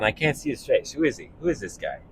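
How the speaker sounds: ordinary delivery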